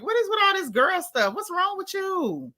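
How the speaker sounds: mock-exasperated reply to the girl talk; exasperated high retort. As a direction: ask it high and fed up. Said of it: "Girl, I'm like".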